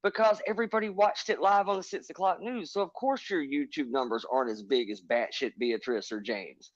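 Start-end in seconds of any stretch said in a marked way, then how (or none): none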